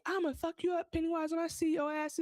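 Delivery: retold kid's trash talk, high; tough kid threat. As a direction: puff up like a kid talking big. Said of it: "And Will is like".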